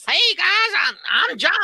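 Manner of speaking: high, twangy country caricature; weird country accent. Retold or imitated. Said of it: "it's a weird, like, country".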